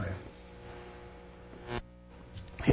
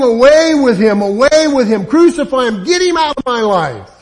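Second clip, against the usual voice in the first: louder, higher mob-like cry; rejecting cry; 7 semitones higher, 9 dB louder than usual. Retold or imitated. Listener's heart echoing the crowd, louder rejecting cry. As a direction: throw him out of your life.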